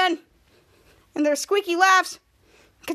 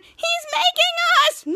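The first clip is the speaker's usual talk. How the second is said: very high, slow, drawn-out wail; high-pitched mock panic; panicking mole person; 14 semitones higher, 3 dB louder, slower than usual. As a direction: imitate a creature crying out in alarm. Mole people panicking at the light.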